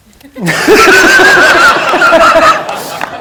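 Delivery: a big high whooping laugh; real roaring laugh at the memory; loud burst of laughter. Real, not acted.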